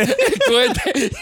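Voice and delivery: laughing through the words, slow; cracking up over the rocket. Real, not acted. Friend laughing at the joke, cracking up.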